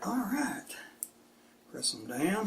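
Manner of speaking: a soft unvoiced all right; whispered aside. Real, not acted. Host mutters to himself while sliding cards in, whispered. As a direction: breathe it to yourself.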